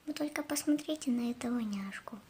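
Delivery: tender whisper over a cute dog. Real, not acted. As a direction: whisper it, melting. Шепчет, умиляясь собаке. (нежный шёпот)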